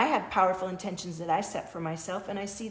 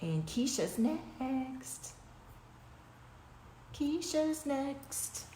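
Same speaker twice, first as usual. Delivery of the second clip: slow, higher sing-song; sing-song announce; 6 semitones higher, 7 dB quieter, much slower than usual. Real, not acted. Host calling the next viewer, sing-song. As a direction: drawl out Keisha's next like a call.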